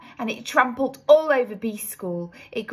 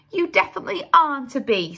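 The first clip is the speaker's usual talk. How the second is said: queen declaring; 5 semitones higher, 5 dB louder, faster than usual. A narrator's reading. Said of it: "said the Queen".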